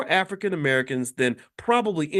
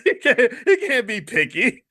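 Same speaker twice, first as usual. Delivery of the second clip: higher, laughing through the words; 7 semitones higher, 5 dB louder than usual. Real, not acted. Host laughing at the idea of a picky prisoner, higher. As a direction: chuckle while you say it.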